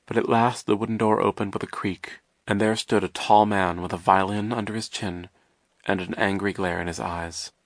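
ordinary delivery, nothing marked

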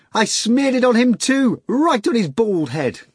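high, loud giggly boast; gleeful. A narrator's reading. Natasha brags to Margarita, high and gleeful. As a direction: boast with mischievous delight. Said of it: "Natasha replied".

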